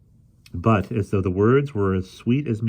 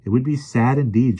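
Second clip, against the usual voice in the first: plain reading voice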